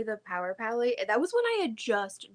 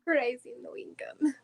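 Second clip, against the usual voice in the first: whisper; 5 semitones higher than usual. Pretend. Joking echo of a meme.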